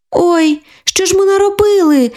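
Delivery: put-on high-pitched little girl's oops; dismayed exclamation. A narrator's reading. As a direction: cry out in childish dismay. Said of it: "вигукнула кирпатенька".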